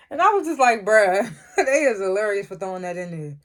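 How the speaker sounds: talking through real laughter; cracking up as she speaks; laughing. Real, not acted. Vlogger cracking up at the show's Rico joke, laughing.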